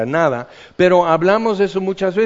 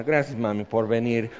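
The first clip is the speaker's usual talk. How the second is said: mock tender thanks; 8 semitones lower, 8 dB quieter than usual. Mock thanks to his mother.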